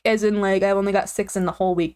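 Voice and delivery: ordinary delivery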